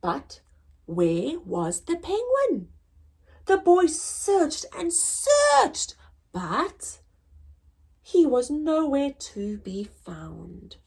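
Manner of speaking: lively storybook narration, rising and falling; reading to children. Acted. Teacher reads Lost and Found to pupils, storytelling voice. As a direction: tell the story to a class of kids.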